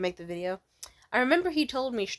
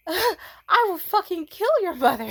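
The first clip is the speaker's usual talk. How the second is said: loud, higher, slow, laughing through it; hammed-up drunken threat, cracking up; mock drunk vow, shouted through laughter; 10 semitones higher, 9 dB louder than usual. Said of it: "tipsy and drunk as fuck".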